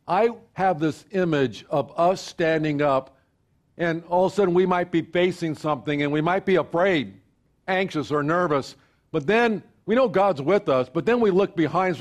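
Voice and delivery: building pastor's call to unity; preaching. Acted. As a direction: preach it out to the congregation. Preaching at full force.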